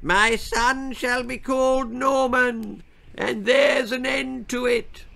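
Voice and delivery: high, slow royal decree; decreeing old king. A narrator's reading. King ending the argument, decreeing. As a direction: pronounce it as final. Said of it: "would not have his decision challenged".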